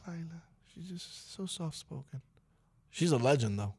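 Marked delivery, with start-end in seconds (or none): 0.1-2.2 soft-spoken. Retold or imitated. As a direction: murmur like a late-night radio host. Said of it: "her voice could put you to sleep".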